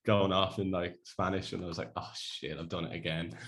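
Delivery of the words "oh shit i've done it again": muttered regret. Retold or imitated. Spanish learner out of his depth, muttered regret. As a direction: mutter it to yourself, caught out.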